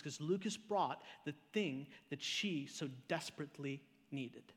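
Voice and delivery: low, soft, reflective sermon voice; hushed earnest preaching. Acted. Preacher draws meaning from his daughter's search, hushed and earnest. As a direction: bring it right down, intimate and sincere. Preacher turns story to its lesson.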